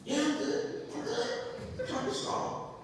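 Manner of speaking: high, soft, choked-up brave face; mock crying. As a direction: imitate holding back tears, voice going high. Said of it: "I was crying at the register".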